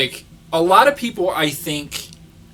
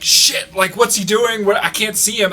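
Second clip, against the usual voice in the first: mock alarm; 6 semitones higher than usual. Voicing baffled cops in a retold chase.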